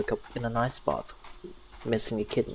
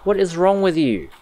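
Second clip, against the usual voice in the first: loud, higher frantic yelling; frantic shouting; 3 semitones higher, 6 dB louder than usual. Real, not acted. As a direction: shout at the monster in panic. Yelling at attacking Satan.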